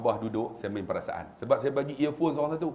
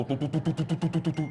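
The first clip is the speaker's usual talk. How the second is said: rapid even put-put imitation; motorbike engine noise. Pak Long riding up on a Harley, imitated engine. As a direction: chug like a big motorcycle engine. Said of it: "dia main naik motor Harley Davidson".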